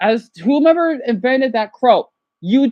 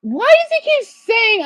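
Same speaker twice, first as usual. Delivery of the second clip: high, exasperated outcry; 10 semitones higher than usual. Real, not acted. Viewer complaining about a streamer, exasperated. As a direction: protest in a raised pitch.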